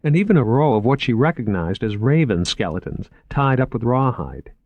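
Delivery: plain reading voice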